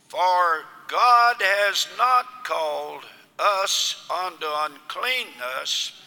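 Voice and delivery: ordinary talk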